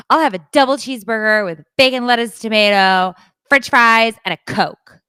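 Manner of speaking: loud, quick order rattled off; mimicked rude customer. Daughter mimicking customers who skip hello, loud demanding. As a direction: bark the order, high and loud.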